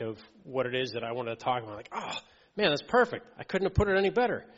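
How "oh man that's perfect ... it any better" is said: high, loud, quick burst of delight; excited delight. Retold or imitated. Hearing a pastor cover his point, thrilled high exclaim. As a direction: gush like the sermon was made for you.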